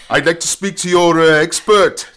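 loud, high caller voice; irate phone-in caller. Acted. Listener phoning a radio show to reach the expert, loud caller voice. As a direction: pipe up as an indignant radio caller. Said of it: "people phoning in and go".

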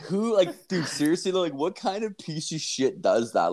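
acted-out exasperated questioning; retold incredulity. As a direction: call him out, high and incredulous. Retold incredulous comeback to the troll.

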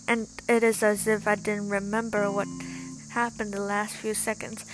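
ordinary delivery

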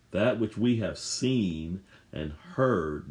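That which we have seen and heard, each word drawn out slowly; slow it right down, let every word of That which we have seen and heard land; slow, deliberate stress through the phrase; slow emphatic reading. Shown as a demonstration. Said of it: "Notice John is emphasizing the physical senses".

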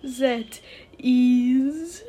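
loud THAT, then a long rising IS; dramatic build-up. A narrator's reading. The bow about to reveal its name, IS drawn out. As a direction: stretch IS upward and leave it hanging. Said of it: "paused for even more dramatic effect".

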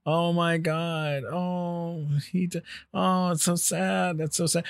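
soft, slow, mock-tragic sighing; hammed-up fake grief, soft and slow; mock sorrow. Mocking sad online mourners.

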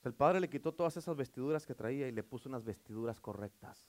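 soft, low, intimate preaching; hushed sermon close. Acted. Preacher softly urges the congregation to forgive, hushed and intimate.

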